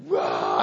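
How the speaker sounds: loud, low imitated roar; mock enraged roar. Preacher acting out pastor charging at the biker, enraged roar. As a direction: roar like the Hulk going nuts. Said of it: "Joey went nuts".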